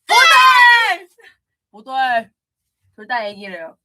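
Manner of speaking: slow, loud laughing protest; laughing shout. Real, not acted. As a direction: shout it while cracking up.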